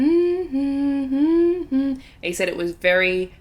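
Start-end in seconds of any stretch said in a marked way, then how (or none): 0.0-1.9 high, sing-song humming; imitated child's humming, high and loud; children's humming. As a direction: hum an eerie little tune. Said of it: "he heard humming, like a song".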